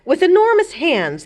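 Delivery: usual speaking voice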